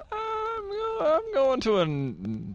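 very high, drawn-out stalling; mock awkward hesitation. Nerd reluctantly admitting his destination to strangers, mock awkward. Acting out an awkward small-talk exchange.

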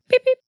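very high squeaky beep-beep; mock beep sound effect. Shown as a demonstration. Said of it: "Here's the blooper sound effect".

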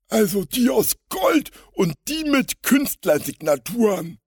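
high, loud, crafty crook voice; specify it with scheming relish, pitched high; sly villain. A narrator's reading. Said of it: "Seiner Stimme konnte man die Verschlagenheit". (verschlagene Schurkenstimme)

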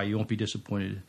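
usual speaking voice

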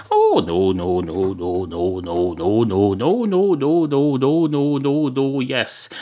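mock-reassuring string of no's, rising in pitch; playfully overdone denial; teasing denial. Barman's drawn-out teasing denial.

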